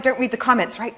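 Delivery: ordinary talk, nothing marked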